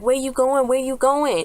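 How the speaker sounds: high-pitched imitation of frantic calling; mock panicked call. Amy to the driver running away, high mock panic. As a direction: call after him in a high, mock-alarmed voice.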